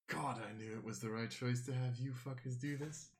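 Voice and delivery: softly pleased villain; hushed, smug satisfaction; quiet gloating. Acted. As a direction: savour it quietly, half to yourself. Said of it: "he just smiles and is like".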